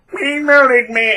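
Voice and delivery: high, loud, drawn-out mock wail; hammed-up victim cry; playing the victim. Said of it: "play the victim and go".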